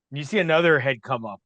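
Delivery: usual speaking voice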